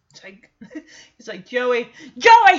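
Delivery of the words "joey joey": louder calling of Joey's name; mock yell in ear. Jesse yelling to wake sleeping Joey, mock loud call. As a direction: call him loudly, like waking a sleeper. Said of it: "he's just screaming in his ear".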